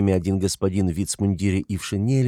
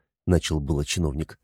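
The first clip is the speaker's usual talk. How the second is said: usual speaking voice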